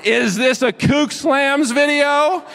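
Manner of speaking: hammed-up mocking disbelief; mock outrage. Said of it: "Instagram handles for people who make silly mistakes".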